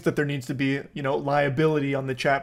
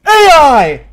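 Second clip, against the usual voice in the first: sudden yelp, much higher and louder; mock jump-scare yell; 11 semitones higher, 14 dB louder than usual. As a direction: blurt it like a jump scare just hit you. Jump scare hitting the mother.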